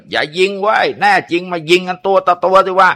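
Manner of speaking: high-pitched, defiant yell; frenzied shout. A narrator's reading. Cornered bandit challenging police to a duel, frenzied shouting. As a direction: shout it out like a crazed outlaw. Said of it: "ตะโกนออกมาอย่างบ้าเลือด".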